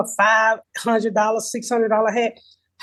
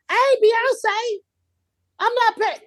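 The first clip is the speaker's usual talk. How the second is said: high, slow, sassy protest; sassy indignation; 8 semitones higher, slower than usual. Real, not acted. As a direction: pitch way up and draw it out. Ranting about cereal prices.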